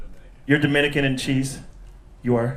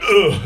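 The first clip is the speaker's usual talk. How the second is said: mock disgusted grunt; 6 semitones higher, 7 dB louder than usual. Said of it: "one of the dudes in the audience went".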